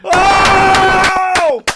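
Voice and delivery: loud, high laughing shouts of triumph; victory yell. Real, not acted. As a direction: cheer like your team just won. Celebrating a trolling victory.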